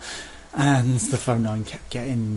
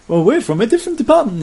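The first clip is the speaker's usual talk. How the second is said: high, loud, fast prissy agent voice; mock smug agent; 13 semitones higher, 11 dB louder, faster than usual. Sky agent excusing another call, mock prissy high voice. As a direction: sneer out the excuse.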